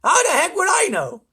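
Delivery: loud, very high outburst; irate controller shouting; exasperated. A narrator's reading. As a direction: snap back in exasperation. Ground control loses patience.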